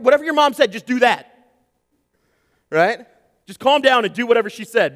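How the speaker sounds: loud, high pleading commands; shouting over kids. Retold or imitated. Dad shouting at daughters to obey mom, loud. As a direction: yell the order over the noise. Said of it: "I try to shout over it".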